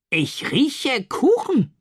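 high, slow, drawn-out character voice; sniffing old neighbour. A narrator's reading. Frau Metzler enters and announces the cake in a high, slow voice. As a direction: savour each word like an odd neighbour.

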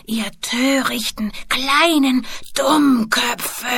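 very slow venomous hiss, building from whisper to strained cry. Acted. Old boy full of hatred, hissing at the children. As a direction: hiss it out word by word, swelling with fury. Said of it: "zischte er".

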